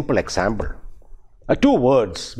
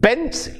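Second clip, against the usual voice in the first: "pencil" with PEN pitched much higher; teacher modelling "pencil" as PEN-cil; stress on PEN; 11 semitones higher than usual. Shown as a demonstration. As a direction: lift and punch the "pen" of "pencil". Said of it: "We say".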